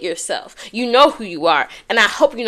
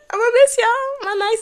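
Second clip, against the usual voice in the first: much higher, half laughing half crying; 13 semitones higher, 3 dB louder than usual. Real, not acted. Vlogger misses her editors, laughing through tears.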